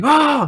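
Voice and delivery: sudden high yelp of pain; mock pained yelp. Recruit's hand is pinned to the wall, high mock yelp. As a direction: yelp as a knife goes through your hand. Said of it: "He's like".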